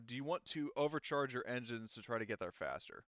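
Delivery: ordinary talk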